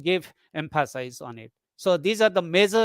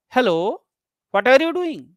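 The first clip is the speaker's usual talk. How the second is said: rising "Hello", pause, then "what are you doing" higher and louder, falling at the end; two tone groups; 5 semitones higher, 5 dB louder than usual. Shown as a demonstration. Teacher reads out the second example, "Hello / what are you doing" as two tone groups. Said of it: "you find two tone groups".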